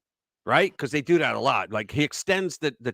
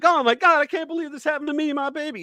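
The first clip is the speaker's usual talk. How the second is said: mock wailing; 12 semitones higher, faster than usual. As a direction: wail like a melodramatic mother. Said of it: "they go on a wailing tour".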